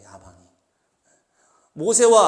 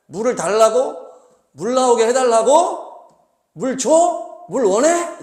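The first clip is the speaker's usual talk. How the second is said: sharp, higher, louder mocking questions; irritated questions; 6 semitones higher, 9 dB louder, slower than usual. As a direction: snap back the questions like an exasperated Moses. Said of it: "지금 열받은 거예요".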